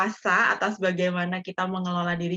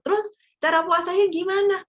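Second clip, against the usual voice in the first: much higher quoted question; mock quizzing in a raised pitch; 10 semitones higher than usual. Imagined questioner asking Dian how she fasts, questioning.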